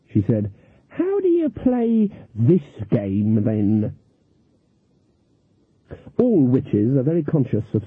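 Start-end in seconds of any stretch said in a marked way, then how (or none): 0.9-3.9 card sharp's mark asking naively; innocent old lady question. Acted. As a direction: feign total innocence.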